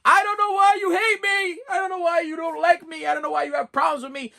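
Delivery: hammed-up high sobbing whine; mocking whiny family. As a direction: mimic the family playing innocent and hurt. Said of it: "They like".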